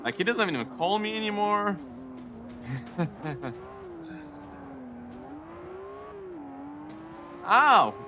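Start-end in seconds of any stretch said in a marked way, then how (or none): 1.8-7.4 long high giggling fit. Real, not acted. Car wrecked again. 7.5-7.9 sharp yelp. Real, not acted. Crash in the race.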